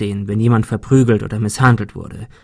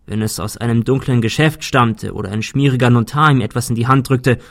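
plain reading voice

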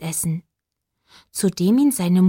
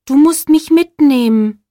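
pleading shooting star; 6 semitones higher, 7 dB louder than usual. Acted. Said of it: "sagte die Sternschnuppe".

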